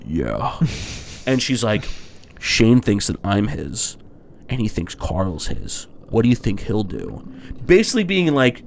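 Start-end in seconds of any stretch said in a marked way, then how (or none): 0.1-0.6 imitated very low one-word reply; acted low, understated yes; low admission. Retold deadpan admission by the man. 2.4-7.3 low, slow, deliberate imitation; acted scheming wife, measured and low; pointed insinuation. Acting out Lori pressing her husband.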